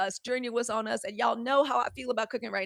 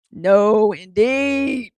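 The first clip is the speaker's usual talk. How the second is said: loud, drawn-out imitation of a refusing grandma; emphatic mock refusal; 13 dB louder, much slower than usual. Grandma refusing fair food, imitated loud and drawn out. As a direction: boom it out like grandma laying down the law.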